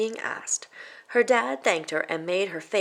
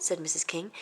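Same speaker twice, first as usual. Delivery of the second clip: plain reading voice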